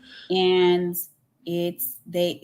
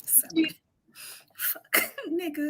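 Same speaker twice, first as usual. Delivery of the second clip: breathy whispered word; whispered disbelief; 7 semitones higher, 6 dB quieter than usual. Real, not acted.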